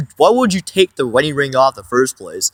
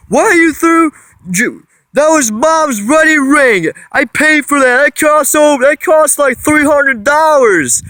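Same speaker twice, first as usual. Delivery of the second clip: stepdad screaming; 11 semitones higher than usual. Retold or imitated. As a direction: yell it at the top of your lungs, voice jumping up. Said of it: "he screams over the top of his lungs".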